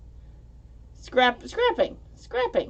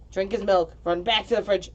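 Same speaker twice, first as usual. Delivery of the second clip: normal talking voice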